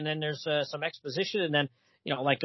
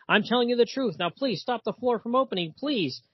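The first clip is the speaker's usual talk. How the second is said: a touch of pleading, higher; 6 semitones higher than usual. Retold or imitated. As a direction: beg them to stop the floor, a bit higher. Begging over the acid floor.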